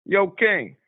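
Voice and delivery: put-on amateur New York accent with a Southern twang creeping in. Southern caller attempts a skit for the radio host, New York accent. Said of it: "do my New York skit".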